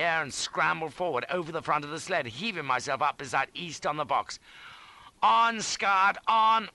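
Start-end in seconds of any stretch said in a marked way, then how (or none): 5.2-6.7 loud, high cry driving the horse on; urging shout. A narrator's reading. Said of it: "I shouted".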